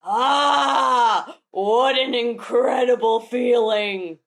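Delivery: loud drawn-out "ah", slow relish; exulting demon. Acted. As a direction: play a newly turned demon reveling in it. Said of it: "And he goes".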